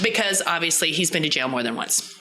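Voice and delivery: ordinary talk, nothing marked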